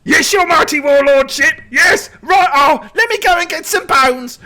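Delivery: high, loud grovelling servant voice; grovelling shaman. Acted. Shaman grovelling to the angry warlord, high squeak. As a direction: scramble to obey, fawning. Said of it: "immediately looks at him and goes".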